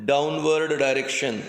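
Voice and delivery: very slow, spaced-out dictation. Acted. Teacher dictating a definition to students, slow dictation. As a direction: dictate in short chunks with long pauses.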